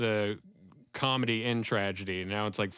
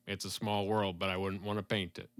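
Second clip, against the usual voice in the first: lower, softer one-liner delivery; deadpan stand-up; 4 semitones lower, 6 dB quieter than usual. Retold or imitated. Fan quoting deadpan comics' one-liner, low understated delivery. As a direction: drop low and quiet for the joke. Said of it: "they're just going up there and, like".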